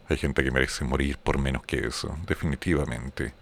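ordinary talk

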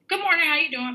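high, bright sing-song good morning; cheery greeting. Retold or imitated.

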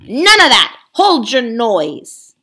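loud snarl, slowing on the last words; snarling ape. Acted. Ape silencing the protesting beasts with a snarl. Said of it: "said the ape with a snarl".

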